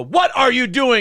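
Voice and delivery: a mother snapping at her son; hammed-up angry mom yell. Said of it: "whose mom would come and go".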